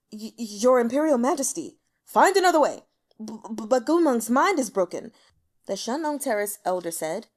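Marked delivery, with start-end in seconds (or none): 0.1-5.1 loud panicked stammer; stuttering in fear. Acted. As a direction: stammer out a desperate plea. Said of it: "stuttering for several seconds".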